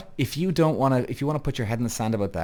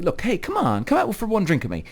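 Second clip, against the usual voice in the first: higher coaxing plea; pleading ask-out; 9 semitones higher than usual. Retold or imitated. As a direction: coax her into one drink. Guy pleading for a date.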